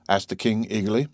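plain reading voice

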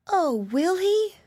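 high, slow, delighted "oh"; drawn-out high-pitched delight; eager cry. A narrator's reading. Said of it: "cried Ethel Rose".

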